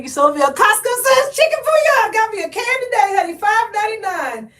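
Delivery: high, loud mock-excited friend voice; imitating a friend. Vlogger imitating a friend thrilled over a cheap Costco buy. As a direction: play the hyped friend bragging about a bargain. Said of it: "She's like".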